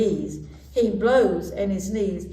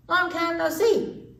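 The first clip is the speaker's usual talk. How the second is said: high rabbit voice; 9 semitones higher than usual. A narrator's reading. Rabbit greets mouse.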